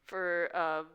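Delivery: ordinary delivery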